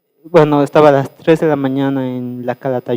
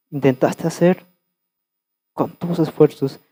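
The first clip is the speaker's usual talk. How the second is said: gentle divine voice; 4 dB quieter than usual. Retold or imitated. Voices the Holy Spirit speaking.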